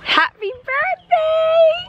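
very high, drawn-out cheer; excited. Real, not acted. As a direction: call it out high and bright. Cheery high birthday call.

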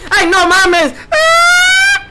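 sudden high shriek of fright; scared shout. Real, not acted. Streamer shrieks at a sudden scare, frightened. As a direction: yelp at a jump scare. Jump scare while playing.

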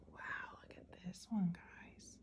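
hushed whisper. Real, not acted. Aside to kids.

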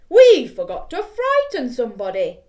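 loud booming voice; big skeleton. Acted. Big skeleton tells friends, loud. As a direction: boom it out.

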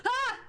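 short, loud high yelp; startled scream. Real, not acted. Streamer jumping at a scare, yelping. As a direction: yelp at a sudden fright.

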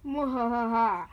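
slow hammed-up villain cackle; mock evil laugh.

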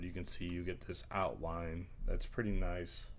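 normal talking voice

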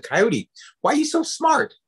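high, wondering story character; lightly voiced curious Badger. Badger curiously questions Coyote.

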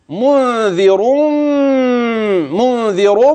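منذر with nasal ghunna on the n and a heavy rolled ر; Quran recitation demo. Shown as a demonstration. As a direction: recite منذر slowly, hold the ghunna, make the ر full. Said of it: "هتغن وتفخم الراء". (تلاوة منذر بالغنة وتفخيم الراء)

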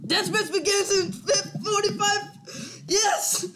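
high, thrilled cheer; excited. Real, not acted. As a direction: cheer it, can't believe it. Excited about reaching deathmatch.

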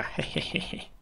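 breathy voiceless mischievous hehehe; a sly little giggle like a mischievous kid; mock sneaky giggle. Player sneaking ahead of the NPC, mock sneaky giggle.

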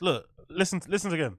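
ordinary talk, nothing marked

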